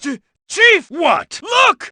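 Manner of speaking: high, stammering frightened shout; panicked yelp. Acted. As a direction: squeal for the chief in panic. Soldiers caught by surprise.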